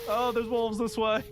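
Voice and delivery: high frightened cry; terrified horror victim. Real, not acted. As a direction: yelp in fresh alarm. Cornered by wolves.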